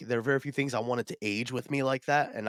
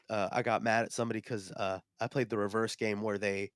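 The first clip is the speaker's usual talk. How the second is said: ordinary delivery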